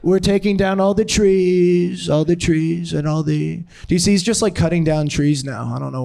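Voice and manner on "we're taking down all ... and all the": hammed-up presidential impression, raised pitch; Trump. Comic plays president razing Busch Gardens, raised mock-politician voice. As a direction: pronounce it like a self-pleased politician mid-speech. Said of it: "Trump just".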